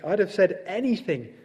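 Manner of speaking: high-pitched, swooping Toad voice; impatient Toad waving it all away. A narrator's reading. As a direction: gush dismissively, pitch riding high. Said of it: "said Toad impatiently".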